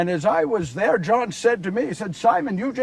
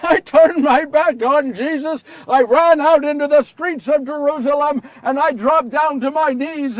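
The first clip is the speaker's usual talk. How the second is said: louder, higher, building grief; anguished telling; 5 semitones higher, 6 dB louder than usual. A narrator's reading. Peter recalling turning his back on Jesus: loud anguish. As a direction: pour out the remorse, rising. Climax of the betrayal story.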